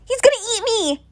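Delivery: high, panicked kid's wail, mock-crying; imitating a crying kid. Scared classmate sobbing that the Chihuahua will eat him. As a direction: pitch it way up like a scared eight-year-old. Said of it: "crying, and goes".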